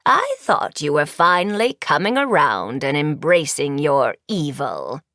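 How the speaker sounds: low witch voice, dragging at the end; disappointed old sorceress. A narrator's reading. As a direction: lament it low as a wicked old teacher.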